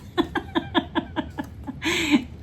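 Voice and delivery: a high embarrassed giggle; giggling. Real, not acted. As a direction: laugh it off after catching hair. Laughing at hair in mouth.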